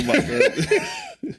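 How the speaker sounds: squeaky laugh through the words; words slowed and broken by laughter. Real, not acted. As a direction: crack up while saying it. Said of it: "You killing it, King".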